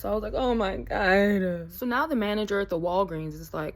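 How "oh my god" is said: drawn-out, exasperated retold wail; exasperated dismay. Stranded with a dead phone, exasperated dismay. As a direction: stretch it right out in mock despair.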